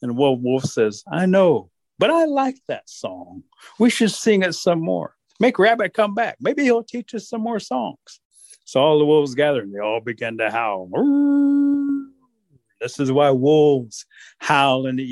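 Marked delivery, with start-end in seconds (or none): 1.1-8.2 higher, slow longing voice; hope Rabbit comes back; wistful wolf. Acted. Wolf muses. 10.9-12.1 wolf howl. Acted. Wolves howl.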